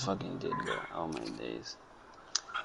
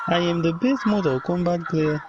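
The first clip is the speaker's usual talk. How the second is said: put-on boastful Nigerian accent, pitched up; 5 semitones higher than usual. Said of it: "Nigerian accent".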